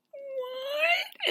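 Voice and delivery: very high, thin, drawn-out wail; mock tearful shock. Women hearing the party is canceled, mock devastated. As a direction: squeak it out as if devastated.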